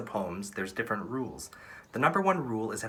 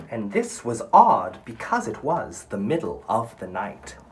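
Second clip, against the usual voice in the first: slow, louder, lilting recitation of the verse; poem reading; 7 dB louder, slower than usual. Acted. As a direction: read it like a storyteller savoring each line. Reads poem aloud to class.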